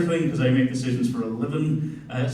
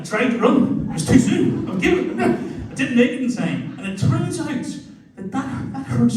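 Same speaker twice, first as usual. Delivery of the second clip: laughing; 8 semitones higher than usual. Real, not acted. Giggling while retelling.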